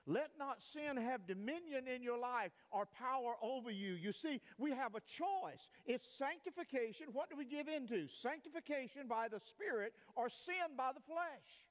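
rising pulpit exhortation; preaching. Acted. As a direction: lift it to the whole church like a sermon peak.

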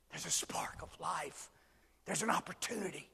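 whispered hope. Real, not acted. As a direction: breathe it out softly. Soft hushed sermon moment.

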